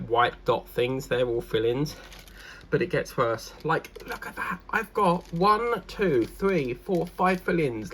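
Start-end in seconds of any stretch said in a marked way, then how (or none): 4.7-7.9 high, slow dismayed counting. Real, not acted. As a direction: count them out slowly, dismayed.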